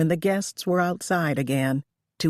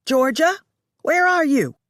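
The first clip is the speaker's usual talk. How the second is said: high, loud call through the house; 11 semitones higher, 6 dB louder than usual. A narrator's reading. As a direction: shout to find someone. Lola calling for Georgia.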